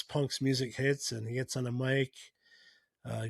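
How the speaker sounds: ordinary talk, nothing marked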